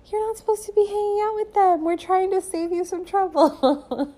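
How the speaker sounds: high, small inner voice. Acted. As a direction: chide in a tiny, high-pitched put-on voice. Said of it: "my little higher self voice would whisper".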